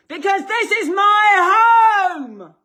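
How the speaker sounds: high, slow bellow with HOME drawn out; real full-voiced, long-held shout; furious shout. Real, not acted. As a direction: roar it at intruders in your own home. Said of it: "'cause you're shouting at me".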